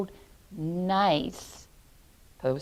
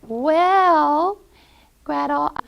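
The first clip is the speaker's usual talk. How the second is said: lightly voiced witch, higher; louder drawn-out lead-in to a trick; coaxing witch; 6 semitones higher, 7 dB louder, slower than usual. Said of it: "she said".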